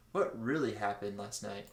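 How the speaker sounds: ordinary talk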